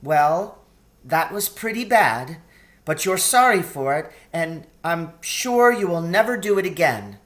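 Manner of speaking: put-on a gentle scolding young girl. A narrator's reading. Lucy speaking to the fawn.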